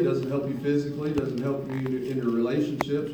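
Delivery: usual speaking voice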